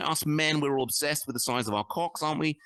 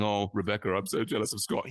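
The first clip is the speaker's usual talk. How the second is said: mock jealous bloke; 8 semitones lower than usual. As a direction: lower put-on voice, moaning with envy. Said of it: "I'd be saying".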